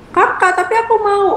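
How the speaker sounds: suara anak kecil yang tinggi, sedikit merengek. Retold or imitated. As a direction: menirukan adik yang ingin mainan. (ibu menirukan suara anak kecil yang tinggi)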